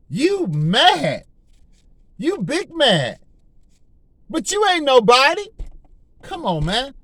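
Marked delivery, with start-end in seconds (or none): 0.1-5.5 high, slow mocking jeer; taunting the devil. Believer mocking the defeated devil, high jeering taunt. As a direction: belittle him, you big mad. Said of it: "we need to be telling the devil".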